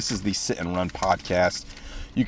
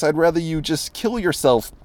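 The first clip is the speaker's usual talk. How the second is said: ordinary delivery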